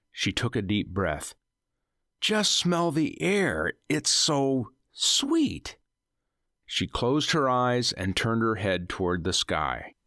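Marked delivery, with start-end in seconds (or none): 2.2-5.8 higher, slow and dreamy; dreamy delight. Acted. Wife lost in the sweet air, slow and dreamy. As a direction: savour every word, slowly.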